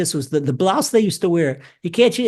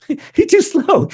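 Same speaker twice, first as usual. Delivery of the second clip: laughing, much higher and louder, slowed down on too slow; laughing disbelief; 10 semitones higher, 4 dB louder, slower than usual. Real, not acted. Preacher repeats the general's jab, laughing out loud and amused. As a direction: burst out laughing as you repeat it, drawing it out.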